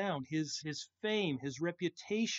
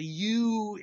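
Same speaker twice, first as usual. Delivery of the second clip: you said higher and louder as the key word; emphatic you; 5 semitones higher, 9 dB louder than usual. Shown as a demonstration. Preacher showing the congregation the emphatic you. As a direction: lean hard on you. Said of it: "is emphatic".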